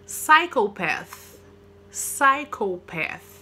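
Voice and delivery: Psychopath said as SY-ko-path, very slow; slow model, silent P. Shown as a demonstration. Teacher slowly models the word, Psychopath with silent P. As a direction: Psychopath with no P at the start. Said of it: "Não pronuncia o P".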